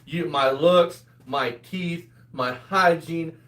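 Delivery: usual speaking voice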